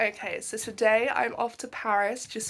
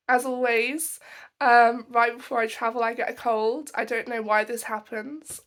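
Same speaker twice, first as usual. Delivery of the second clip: ordinary talk, nothing marked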